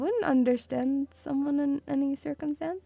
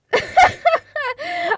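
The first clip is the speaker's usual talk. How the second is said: high peal of laughter; helpless laughter; 4 dB louder than usual. Real, not acted. Streamer cracks up after own movie rant, high laughter.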